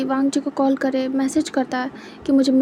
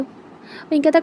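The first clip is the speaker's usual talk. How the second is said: plain reading voice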